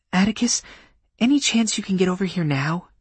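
high-pitched woman's voice, urgent request; female caller. Acted. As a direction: voice a woman phoning for help.

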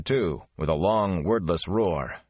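usual speaking voice

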